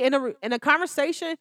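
usual speaking voice

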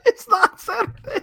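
very high squeak, mock correction; squeaky high voice. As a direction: squeak it out in falsetto.

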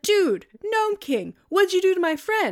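mock-outraged little girl voice; Dorothy. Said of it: "And Dorothy's like".

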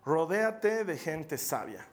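each word laid out slowly; slow emphatic repeat. Shown as a demonstration. Preacher to congregation, slow weighty emphasis. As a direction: drive the point home slowly. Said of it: "Otra vez te lo digo".

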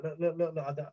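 imitated flustered stutter, restarting words; retold stuttering. Suspect answers the man screaming at him, imitating his own stutter. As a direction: stammer over the first words, then rush the question. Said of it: "I'm stuttering like".

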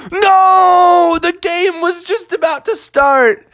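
high, drawn-out wail of protest; dismayed outcry. Acted. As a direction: cry out like let-down fans. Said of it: "everyone was like".

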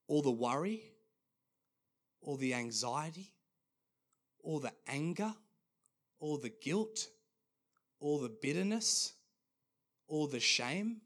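WORRY, ANXIETY, ANGER, GUILT, BITTERNESS, SHAME each lifted high at the end; rising list. Retold or imitated. Jesus listing burdens people hold, each item rising, long pauses.

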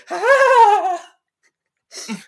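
piercing, loud imitation of a coquettish laugh; high-pitched laugh. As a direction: mimic his voice pitching up into a playful cackle. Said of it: "his voice pitches up and he goes".

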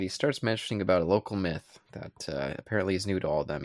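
ordinary talk

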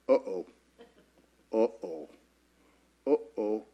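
small, low uh-ohs; dawning worry. Acted. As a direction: quietly realize you are in trouble. Acting Mary's dawning alarm.

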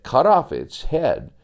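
ordinary delivery

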